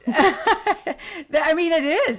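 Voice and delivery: high, loud laugh breaking into the words; laughing through tears. Real, not acted. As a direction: laugh it out while moved. Laughing while tearing up.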